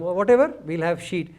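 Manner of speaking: usual speaking voice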